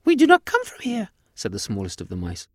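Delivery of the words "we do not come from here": very high, loud squeaky mouse; high-pitched cartoon mouse voice; tiny alien mouse. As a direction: squeak it out like a proud little mouse. Tiny alien mouse speaking in story.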